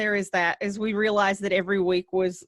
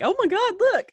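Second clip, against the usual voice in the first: high excited squeal, pitched way up; excited oh my God; 9 semitones higher, 3 dB louder than usual. Retold or imitated. Podcasters reacting to their first shout-out, excited. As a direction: gasp it out like you just got noticed.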